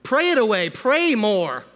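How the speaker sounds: loud, high, slow glib churchy advice; hammed-up pious brush-off; mock preachy advice. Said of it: "The church often tells people".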